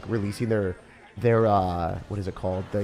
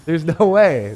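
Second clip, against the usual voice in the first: louder, higher cry of disbelief; disbelieving shout; 6 semitones higher, 9 dB louder, much slower than usual. Real, not acted. Streamer seeing goblins on both sides, loud disbelieving shout. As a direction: yell it like you can't believe your eyes.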